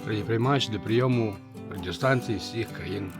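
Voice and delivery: ordinary talk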